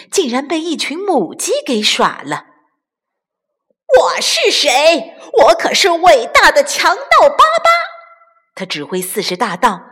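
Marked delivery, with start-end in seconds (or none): 4.0-7.7 furious boast. Acted. Bandit chief raging at being fooled by hens. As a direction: bellow his own greatness.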